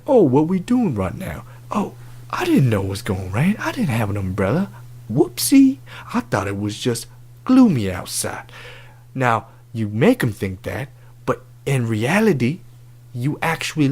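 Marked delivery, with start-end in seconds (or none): 0.1-8.5 hammed-up "who, me?" act; feigned innocence. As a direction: play dumb as if caught off guard. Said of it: "You make it seem like an accident".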